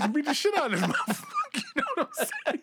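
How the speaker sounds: high laughing through words; cracking up while telling it. Real, not acted. Laughing retelling.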